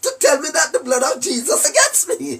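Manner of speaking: fast, gleeful mocking laughter; laughing. Real, not acted. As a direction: cackle at the critics as you talk. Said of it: "that's why I'm laughing at you".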